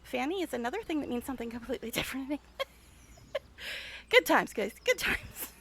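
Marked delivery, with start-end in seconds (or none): 4.9-5.5 laughing echo. Real, not acted. Echoing her own remark, laughing.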